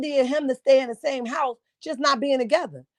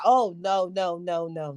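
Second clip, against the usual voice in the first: mocking denial; 8 semitones lower, 3 dB quieter, slower than usual.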